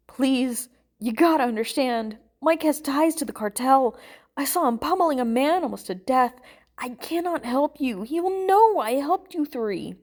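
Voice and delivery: frightened, insistent plea; desperate pleading. Acted. Frightened man begging his captors.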